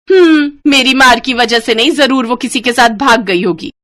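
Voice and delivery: loud, high scornful reply; harsh dismissal. Acted. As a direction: brush the blame off coldly. (तिरस्कार भरी आवाज़)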